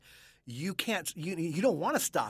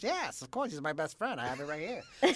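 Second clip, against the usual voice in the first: softer, put-on odd-friend voice; lightly mimicked wannabe Texan; 2 semitones lower, 5 dB quieter than usual. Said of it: "this Tex guy".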